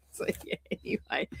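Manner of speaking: whispered aside. Real, not acted. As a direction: drop to a whisper. Trails off after camera struggle.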